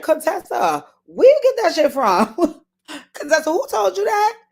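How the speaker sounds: imitating someone cracking up, high-pitched; Qua cracking up. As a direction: squeal it incredulously through a laugh. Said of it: "Qua was cracking up in her confessional".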